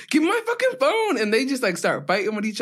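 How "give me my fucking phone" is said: much higher, mock furious yell; hammed-up angry boyfriend. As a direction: squeal it like a caught man losing it. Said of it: "And he was like".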